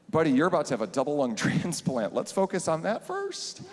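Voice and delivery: high, laughing fond scolding; laughing reassurance. Retold or imitated. Teacher telling student to focus on surgery, laughing. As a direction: laugh him back to reality.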